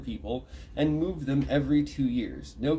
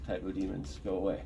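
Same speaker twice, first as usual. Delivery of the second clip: ordinary delivery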